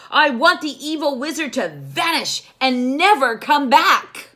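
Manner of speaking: high, loud magic command; commanding spell. Acted. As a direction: cast the spell with power. Princess casts spell.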